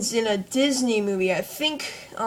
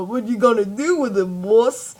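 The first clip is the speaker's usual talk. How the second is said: dopey voice. Shown as a demonstration. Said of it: "he sounds like a little duh".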